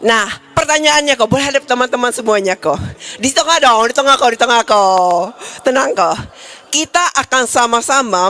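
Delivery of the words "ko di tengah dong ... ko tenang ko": ordinary talk, nothing marked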